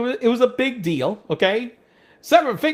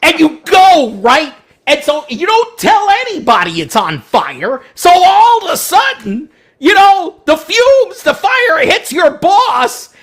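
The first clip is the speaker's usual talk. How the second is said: loud, much higher, worked-up telling; heated rant; 8 semitones higher, 8 dB louder than usual. Retold or imitated. Ranting about coworkers leaving a mess, loud and heated. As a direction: build it up, fired up.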